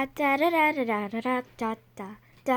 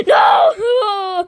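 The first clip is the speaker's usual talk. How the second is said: shout; 7 semitones higher, 8 dB louder than usual. Acted. Shouted denial.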